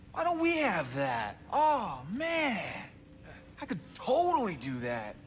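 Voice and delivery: high-pitched, indignant whine of envy; envious outburst. Acted. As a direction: complain with swooping, envious excitement. Jealous outburst about muses.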